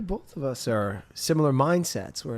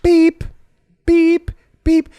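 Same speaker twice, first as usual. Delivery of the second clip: high, loud imitated scanner beeps; 9 dB louder, much slower than usual. Shown as a demonstration. Describing the cashier's shift, loud high scanner beeps. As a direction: beep it out like a checkout scanner.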